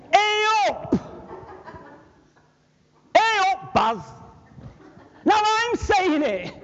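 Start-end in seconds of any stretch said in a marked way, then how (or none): 0.1-1.0 Yorkshire greeting. Retold or imitated. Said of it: "He was from Yorkshire". 3.2-4.0 Yorkshire greeting. Retold or imitated. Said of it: "He was from Yorkshire".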